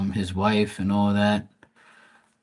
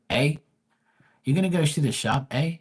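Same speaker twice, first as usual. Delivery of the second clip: quick, high-pitched Canadian "eh" imitation; Canadian accent; 6 semitones higher than usual. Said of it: "You know how Canadians go".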